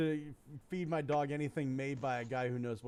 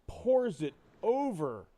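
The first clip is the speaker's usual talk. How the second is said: usual speaking voice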